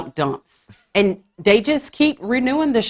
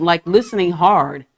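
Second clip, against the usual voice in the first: normal talking voice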